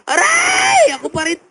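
very high yelp, then whimper; mock pained squeal. Scalded medium insists it is still them, mock squeal.

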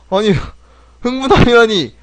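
loud, high cry of disbelief; incredulous exclamation. Real, not acted. Streamer repeats the absurd award name, loudly incredulous.

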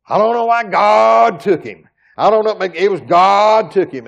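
loud, bewildered preacher at a pulpit; mock the mourners' confusion; imitating funeral speakers. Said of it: "But they kept getting up there".

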